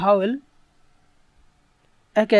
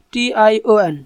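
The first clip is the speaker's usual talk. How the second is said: ordinary delivery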